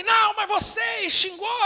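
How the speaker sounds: high, loud, slow yell; angry shout. Retold or imitated. Angry motorcyclist yelling at the driver who hit him. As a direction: bark it back like an angry biker. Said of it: "ele começou a berrar".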